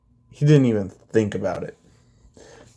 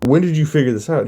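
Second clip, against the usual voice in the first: quick, higher, louder eager question; happy excitement; 5 semitones higher, 5 dB louder, faster than usual. A narrator's reading. Zeus delighted by his son's news, excited. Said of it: "Zeus is happy as fuck to hear that".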